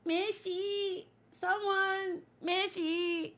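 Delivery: high, thin cry for help; weak pleading call. Acted. Drained character begging someone for food, weak pleading. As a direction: call out feebly in a high put-on voice.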